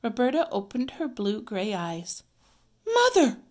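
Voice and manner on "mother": high, loud cry for mother; alarmed cry. A narrator's reading. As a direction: cry out loudly, like a startled child. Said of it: "cried Ramona".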